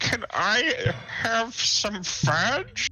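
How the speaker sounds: put-on old granny voice. Player voices granny asking grandson.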